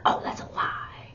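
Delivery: whisper. Pretend. Example of saying lie.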